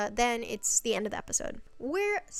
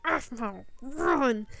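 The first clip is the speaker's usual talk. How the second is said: grumble; 3 semitones higher, much slower than usual. Retold or imitated. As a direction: imitate a rough, rumbling warning. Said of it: "she hears a grumble".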